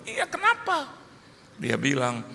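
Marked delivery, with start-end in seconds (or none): 0.0-0.9 high-pitched, impatient comeback; mock panic, voice leaping up; agitated reply. Agitated father answers doctor who told him to calm down, impatient. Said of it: "coba Bapak tenang dulu".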